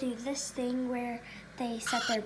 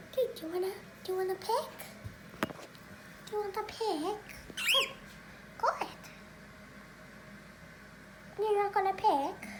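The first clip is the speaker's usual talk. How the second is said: sweet talking to a pet; 6 semitones higher, 6 dB quieter, faster than usual. Real, not acted. Coaxing the bird to pick.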